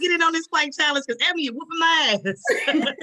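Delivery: high, laughing, joking boast; laughing mock vow. Joking to her friend about the plank challenge, laughing. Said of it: "I'm like".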